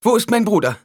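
high, loud cry; urgent call. A narrator's reading. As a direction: call out sharply for him. Alia bursts in, calling.

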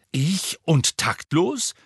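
high, loud, slowed outrage; indignant disbelief, each word stretched out. Acted. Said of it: "sagte Harry empört". (empört, laut und gedehnt)

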